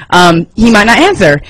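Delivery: normal talking voice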